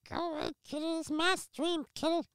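high whiny cartoon kid voice; Eric Cartman. Streamer shooing his cat in a Cartman whine. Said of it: "Eric Cartman voice right now".

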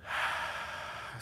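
long audible breath out; meditative exhale. Real, not acted. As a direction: let out a slow, airy sigh. Breath before grounding in meditation.

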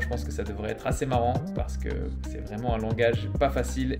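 ordinary talk

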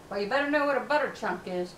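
ordinary talk, nothing marked

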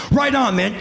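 high, keyed-up farewell; pumped-up enthusiasm. Retold or imitated. Actor grabs the director's offered hand, overexcited. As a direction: say it with hammed-up manic gusto.

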